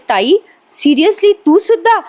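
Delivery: hurt, can't believe it, the words jumping high; a sister's shocked, betrayed cry; shocked disbelief. Acted.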